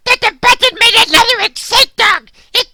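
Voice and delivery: ordinary delivery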